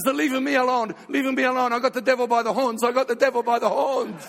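high, loud, triumphant cry at the altar; ecstatic shout. Retold or imitated. Relative at the prayer meeting, loud and ecstatic. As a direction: shout it like you've won the fight.

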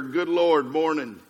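weary exclamation, sinking at the end; dismayed morning groan. Acted. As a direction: dread the day, letting MORNING drop. Two contrasting ways to greet morning.

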